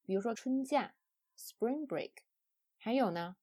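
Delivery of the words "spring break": spring said stressed, break light; spring break with the weight on spring. Shown as a demonstration. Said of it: "它的重音就在第一个单词上".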